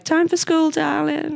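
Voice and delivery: high crone voice; high, crackly old crone; old hag. Retold or imitated. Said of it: "this crone voice that you give her".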